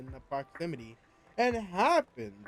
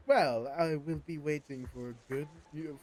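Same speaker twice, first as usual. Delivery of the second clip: usual speaking voice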